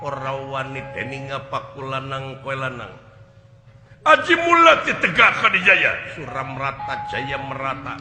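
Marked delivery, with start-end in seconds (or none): 4.0-5.9 incantation. Acted. Acted incantation before fight.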